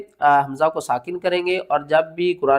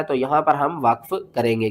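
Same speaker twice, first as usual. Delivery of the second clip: ordinary delivery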